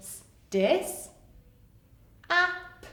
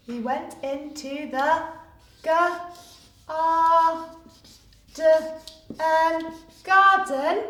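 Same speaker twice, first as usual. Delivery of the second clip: sounding out; 4 semitones higher, 6 dB louder, slower than usual. Shown as a demonstration. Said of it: "sounding out spelling".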